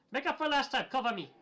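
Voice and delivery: high, loud battle shout; loud, high-pitched call to a partner; in-character yell. Acted. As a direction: holler for cover over the fight. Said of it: "So I, I yell".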